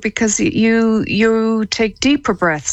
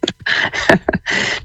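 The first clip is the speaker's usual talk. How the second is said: breathy laugh. Real, not acted. Put on the spot to laugh.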